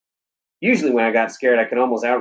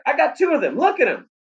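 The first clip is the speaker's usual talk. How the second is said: put-on much higher, thrilled boy's cry; excited shout. A narrator's reading. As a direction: crow about the catch at the top of your voice. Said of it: "I shouted".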